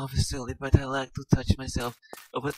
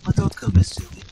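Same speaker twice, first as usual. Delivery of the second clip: lower, whispered desperate plea; breathy plea; 7 semitones lower, 7 dB louder than usual. Acted. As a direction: breathe it out begging him to stay. Lara begs him to stay.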